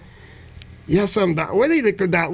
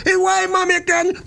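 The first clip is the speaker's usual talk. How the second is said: mother's voice; 10 semitones higher than usual. Acted.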